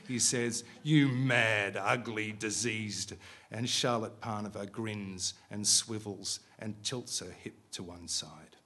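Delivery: steady, measured reading of a novel; story reading. A narrator's reading. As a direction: read it like an author at a bookshop. Author reads novel aloud.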